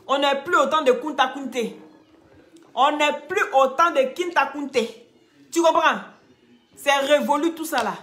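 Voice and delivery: firm, loud telling-off; loud scolding. Real, not acted. Streamer scolding a rude viewer, loud and firm. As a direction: raise your voice and scold the viewer.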